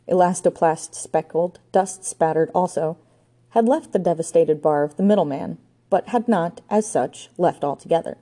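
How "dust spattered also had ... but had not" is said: ordinary delivery, nothing marked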